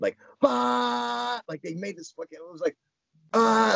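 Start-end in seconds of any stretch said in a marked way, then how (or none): 0.4-1.4 sustained high "bah" sound effect; drawn-out noise of echoing thoughts; imitated echoing blare. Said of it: "all the thoughts in my head echoed". 3.3-3.7 loud, high, sustained "ah"; drawn-out noise of echoing thoughts; imitated echoing blare. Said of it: "everything just kind of fucking, like, echoed".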